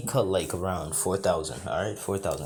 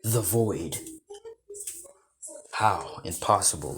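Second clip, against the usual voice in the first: stunned; 2 semitones higher than usual. Acted. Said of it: "he's stunned and says".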